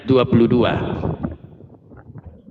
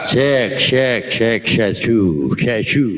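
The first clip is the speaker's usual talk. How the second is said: choppy broken-up audio over the line; bad connection; 5 semitones lower, slower than usual. Real, not acted. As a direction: voice cutting in and out. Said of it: "Putus-putus suaramu, Dalbo". (suara putus-putus)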